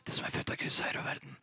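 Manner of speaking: whispered Bible verse; hushed verse under the doctor's voice. Retold or imitated. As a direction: barely breathe the promise. Faith voice starts small.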